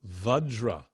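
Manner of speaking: "Vajra" drawn out with a short uh for each A; mantra word modelled. Shown as a demonstration. Teacher models Vajra for students, careful pronunciation. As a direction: say "Vajra" slowly, stretched out. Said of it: "I'm saying it, you're repeating it".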